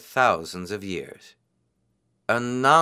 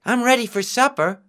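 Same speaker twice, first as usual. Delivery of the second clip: excited; 11 semitones higher, 4 dB louder than usual. A narrator's reading. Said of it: "he cried".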